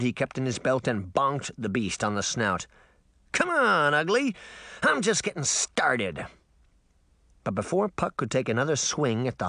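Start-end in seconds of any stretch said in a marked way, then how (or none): 3.3-6.3 taunting. A narrator's reading. Puck taunts the monster.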